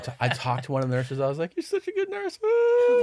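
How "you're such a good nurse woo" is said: very high, hammed-up weepy squeal; mock crying. Patient praising his nurse, bursting into mock tears. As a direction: blubber it, breaking into a mock wail. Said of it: "And I start crying to her".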